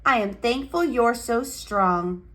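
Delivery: ordinary delivery, nothing marked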